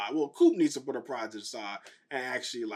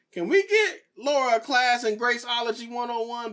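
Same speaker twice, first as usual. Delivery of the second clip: sarcastic plea; 8 semitones higher, 3 dB louder than usual. Real, not acted. Mocking plea about Laura.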